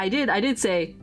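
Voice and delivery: ordinary talk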